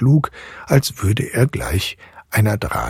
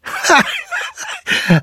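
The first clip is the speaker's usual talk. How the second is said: very high burst of laughter; loud boyish laughter. A narrator's reading. Fritz interrupts the eerie godfather with a high laugh. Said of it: "ihn mit lautem Gelächter unterbrochen".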